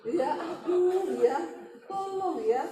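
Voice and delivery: slow, loud, laughing helplessly; real laughter. Real, not acted. Preacher breaks into slow, loud laughter at her own joke. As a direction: laugh it out, almost crying.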